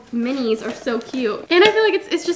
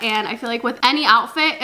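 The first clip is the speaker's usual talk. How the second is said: normal talking voice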